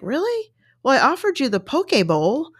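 mock surprise. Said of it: "He's like".

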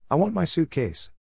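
a child's demand, voiced as an understated yell; mild yell. A narrator's reading. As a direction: raise the pitch for a restrained yell. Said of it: "Sammy yelled".

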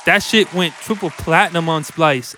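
usual speaking voice

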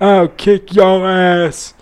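put-on slow drawn-out drunk threat; belligerent drunk. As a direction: slur it out like a brawler. Imitating Stone Cold Steve Austin.

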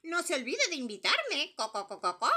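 loud, high clucking hen voice. Acted. Hen clucking her request to number three. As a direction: end with a run of clucks. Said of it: "dijo la gallina".

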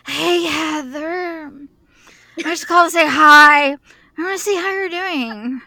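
tipsy caller stretching out the name; telltale drunk. Acted. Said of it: "telltale drunk, like".

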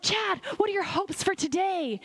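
eager question. Retold or imitated. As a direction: ask your husband brimming with hope. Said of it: "and I was like".

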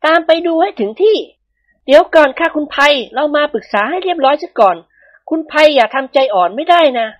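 high, firm woman's voice; a wife set on catching her husband; high-voiced wife, resolute and grim; determined young wife. A narrator's reading. Two wives hunt husbands.

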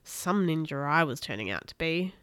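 ordinary delivery, nothing marked

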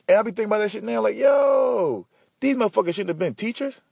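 indignant. Real, not acted. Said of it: "indignant outburst".

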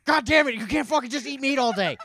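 high, very loud mock outburst; imitated yelling. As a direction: act out losing your temper in a shout. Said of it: "you start yelling".

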